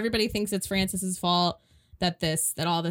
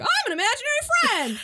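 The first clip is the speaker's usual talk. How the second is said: much higher, silly voice for the invisible guy; goofy high voice; faster than usual. Retold or imitated. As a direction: squeak it like a cartoon pal. Retelling the invisible guy's line.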